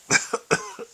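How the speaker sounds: real airy high-pitched chuckle; cracking up at the caller again; breathy high laugh. Real, not acted.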